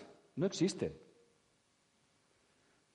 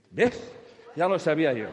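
smug told-you-so; 3 semitones higher, 9 dB louder than usual. Retold or imitated. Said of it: "y dice".

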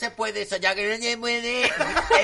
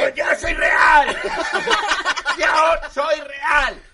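loud, higher, slow drawn-out protest; indignant royal shout; 8 semitones higher, 6 dB louder, much slower than usual. Pretend. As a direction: insist you're royal, laughing. Reina protesta. (reina ofendida gritando que es real)

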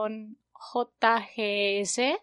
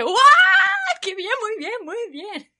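high, over-the-top praise; hammed-up delight; exaggerated praise; 12 semitones higher, 10 dB louder than usual. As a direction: cheer him on like a proud teacher. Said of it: "fue igual de exagerada".

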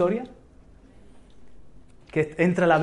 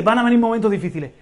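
quick plain echo; 2 semitones higher, 4 dB louder, much faster than usual. Real, not acted. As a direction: rattle off the echo. Preacher repeats warning phrase.

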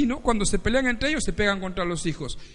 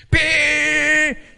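loud shouted honk; mock horn blast; 6 semitones higher, 9 dB louder than usual. Angry driver honking at another car, loud.

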